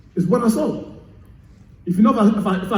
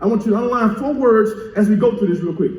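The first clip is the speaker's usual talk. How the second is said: ordinary talk, nothing marked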